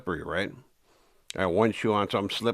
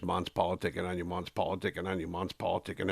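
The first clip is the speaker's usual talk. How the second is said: much softer, low and secretive; retold whisper; 3 semitones lower, 8 dB quieter than usual. Schemer murmuring lies into the boss's ear, soft and low. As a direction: murmur it like poison in his ear. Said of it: "kept whispering in Joe Morgan's ears".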